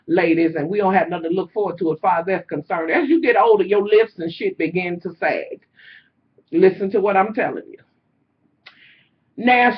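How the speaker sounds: ordinary talk, nothing marked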